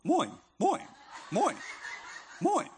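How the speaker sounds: Australian seagull squawk. Retold or imitated. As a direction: squawk like a Finding Nemo seagull. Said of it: "they sounded more like".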